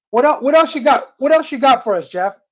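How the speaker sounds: ordinary talk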